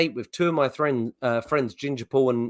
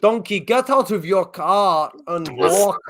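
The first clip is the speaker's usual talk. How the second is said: slow, raised Russian guard order; Russian accent; 4 semitones higher, slower than usual. Acted. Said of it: "where we were Russian guards".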